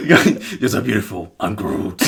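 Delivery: lightly voiced Groot through laughter; half-laughing imitation of Groot. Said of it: "in, like, the Groot voice".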